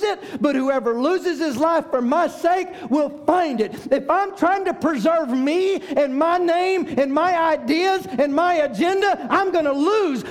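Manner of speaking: high, loud, slowing preacher's cry; preaching at full force. Acted. Pastor cries out to the congregation, full force preaching. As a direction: lift it up and proclaim. Building sermon to a climax.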